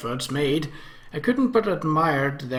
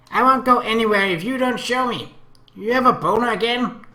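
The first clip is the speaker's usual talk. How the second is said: put-on high, loud, playful girl voice; teasing little sister; 10 semitones higher, 7 dB louder than usual. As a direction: tease him like a mischievous child. Akemi pestering, eyes sparkling.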